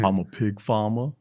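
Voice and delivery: put-on a light, hammy Southern London accent as a disguise; a hint of a Southern London accent, half-joking. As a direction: play a pig farmer with a mild Southern London accent. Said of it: "he put on his Southern London accent".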